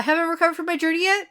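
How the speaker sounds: high, fast mock excuse. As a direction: rattle off a flimsy excuse fast. Lan's excuse, mock voiced.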